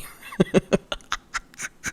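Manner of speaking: wheezy laugh trailing into breath. Real, not acted. Storyteller amused at still finding time to argue, wheezy laugh.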